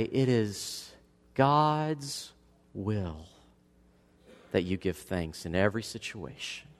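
soft, low, word by word; hushed scripture. Acted. Pastor softly quoting God's will, very low. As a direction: let each word land quietly.

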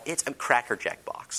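hushed, conspiratorial aside; joking under your breath; whispered aside. Real, not acted. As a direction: whisper it like a secret joke. Joke aside about car.

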